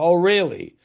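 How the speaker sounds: loud, high, sarcastic retort; mock disbelief. Writer doubts the caller is really Dino, loud sarcastic disbelief. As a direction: humour the caller without believing a word.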